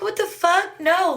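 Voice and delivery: high-pitched, disbelieving outburst; retold incredulous protest. Suspect answering a kidnapping accusation, high incredulous protest.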